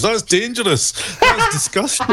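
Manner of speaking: se ríe mientras lo dice; laughing. Real, not acted. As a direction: palabras entre risas. Laughing at own joke.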